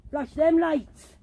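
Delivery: loud, slow, high demand; calling out. Real, not acted. Daring unseen pranksters to flash their torches, loud call. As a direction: challenge the hidden pranksters.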